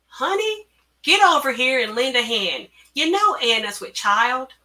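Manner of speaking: high, loud call to a worker; carnival boss. A narrator's reading. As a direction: holler an order across the grounds. Boss orders Honey in story.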